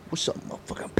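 whispered sniper taunt. Retold or imitated. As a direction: breathe it out like a sniper. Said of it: "I'd be".

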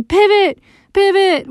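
high barked coach call; barking gym coach. Retold or imitated. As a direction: bark it like a coach. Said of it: "my basketball coach yelling at me".

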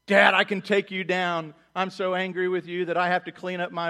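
a lightly voiced angry toddler; defiant toddler. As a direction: act out a cocky little kid. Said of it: "the two-year-old taking on dad".

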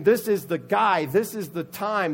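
slow, emphatic rallying declaration; excited crowd member. Retold or imitated. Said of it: "they just are excited".

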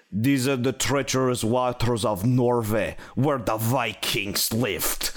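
low, slow fake Norwegian narrator; fake Norwegian accent. Ride narrator introducing Norway, slow fake Norwegian accent. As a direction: intone it deep in a hammy Norwegian accent.

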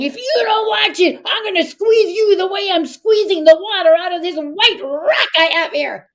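high, forceful storybook threat; threatening. Acted. Ash lad warning the troll as he squeezes, menacing. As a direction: push it out high, stretching the last words.

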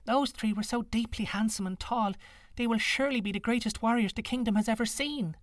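put-on high, light girlish voice; young girl voice. A narrator's reading.

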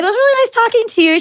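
high, fast sugary farewell; sweet sign-off. Retold or imitated. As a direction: chirp it sweetly, all innocence. Polite sign-off before reveal.

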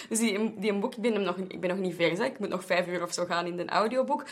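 ordinary talk, nothing marked

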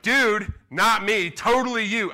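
loud, high retort; mock protest. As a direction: toss the blame right back. Father retorts to son.

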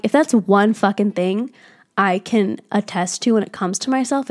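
normal talking voice

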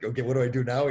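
loud, high, panicky plea; panicked rookie. Retold or imitated. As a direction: blurt it out, suddenly out of your depth. Rookie panics, looks to coach.

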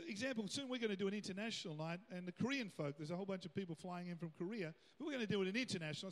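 ordinary delivery

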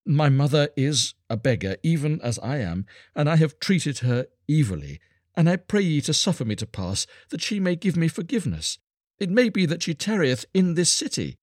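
high-pitched, sorrowful plea; pleading beggar boy. A narrator's reading. Star child seeks his mother's forgiveness, pleading. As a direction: beg humbly with the voice lifted high.

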